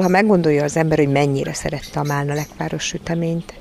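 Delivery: ordinary delivery, nothing marked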